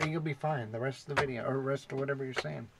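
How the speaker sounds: ordinary talk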